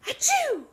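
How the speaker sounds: high-pitched explosive storybook sneeze; loud acted sneeze. A narrator's reading. Mouse sneezes right in bear's ear, explosively. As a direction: burst out with a huge sneeze. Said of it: "And she sneezed".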